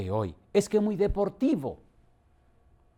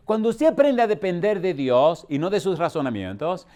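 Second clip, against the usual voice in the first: each repetition louder, building to a peak; building preaching; 3 semitones higher, 7 dB louder than usual. Real, not acted. Preacher building a repeated appeal to the congregation, growing louder. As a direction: stack the lines and climb.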